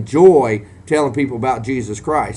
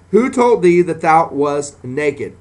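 solemn scripture reading; 2 semitones higher, 4 dB louder, slower than usual. Shown as a demonstration. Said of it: "And he said".

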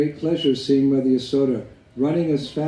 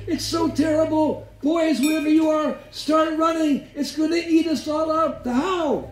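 very high-pitched hammed-up alarm; mock terrified cry. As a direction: wail it out high as a mother faking horror.

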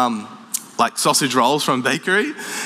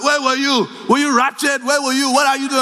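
dad grilling his son, comic high voice; imitating dad questioning; 8 semitones higher than usual. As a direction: demand answers like an outraged father.

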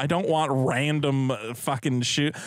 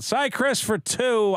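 slow, big announcer read; 7 semitones higher, slower than usual. Acted. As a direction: draw it out like a game show host. Reading super chat announcer style.

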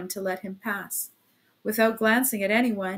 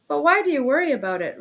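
sudden interjection; 7 semitones higher, 7 dB louder, faster than usual. A narrator's reading. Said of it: "interposed suddenly".

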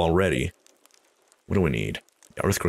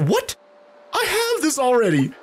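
surprised outrage; 3 dB louder, slower than usual. Real, not acted. Disappointing reward in game.

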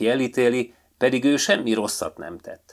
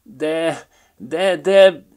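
flustered protest; 9 semitones higher, 9 dB louder, much slower than usual. A narrator's reading. Magpie stammers protest.

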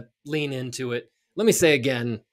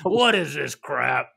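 slow, gruff put-on grumble; grouchy viewer; slower than usual. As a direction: complain like a cranky old man. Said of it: "grouchy viewer".

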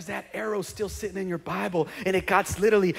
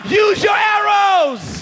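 louder, higher and slow; shouted call; 13 semitones higher, 11 dB louder, much slower than usual. Real, not acted. Preacher leading congregation in shouted call and response. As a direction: call it out for the room to echo. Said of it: "Someone shout".

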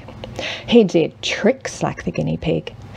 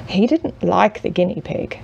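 ordinary delivery

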